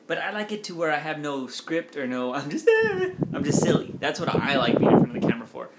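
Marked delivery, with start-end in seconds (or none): 2.7-3.3 squealing laugh burst; high giggle. Real, not acted.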